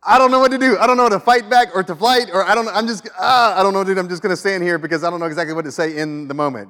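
high, loud flustered voice; mock panic, words tumbling out; panicked freezer. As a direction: act out someone frozen in panic. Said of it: "Freeze is".

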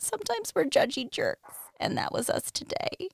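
sheepish whisper. Real, not acted. Confessing they were judgy jerks.

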